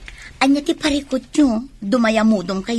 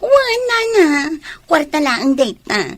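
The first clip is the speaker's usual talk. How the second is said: high, slow, louder cheerful brush-off; eager reassurance; 4 semitones higher, 6 dB louder, slower than usual. Acted. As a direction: sweetly wave away her worry, loud and drawn out. Wife brushes off money question.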